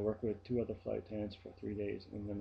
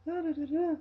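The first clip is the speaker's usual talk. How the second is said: high, louder babbling parody; mimicking her chatter; 6 dB louder than usual. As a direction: prattle on in a squeaky ditzy voice. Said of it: "she talks like this".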